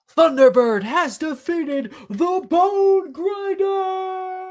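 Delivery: high, slow, roaring announcement; sports announcer. Acted. Announcer declares the racer's victory to the crowd, long high shout. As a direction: draw out the last words triumphantly. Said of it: "the announcer".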